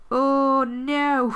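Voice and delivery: real dismay at the torn page; dismayed cry. Real, not acted. Vlogger tearing her planner page, dismayed cry. Rips her day extender.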